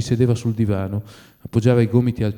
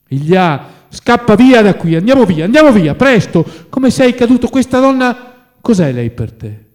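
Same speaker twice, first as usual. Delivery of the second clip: high, loud, desperate alarm; shouted, anguished appeal; horrified urging; 11 semitones higher, 9 dB louder than usual. A narrator's reading. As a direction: urge a friend to flee at once. Horrified friend urging him to flee.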